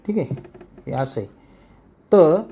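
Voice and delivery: ordinary talk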